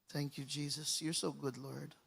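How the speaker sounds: soft, low aside to the Lord; murmured prayer. Real, not acted. Preacher quietly thanking Jesus between readings, murmured. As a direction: breathe it out as a private thank-you.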